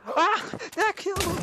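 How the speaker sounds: high, loud yell of delight; excited shout. Real, not acted. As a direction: shout it out in surprise. Sudden kill in game.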